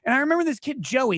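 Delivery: ordinary talk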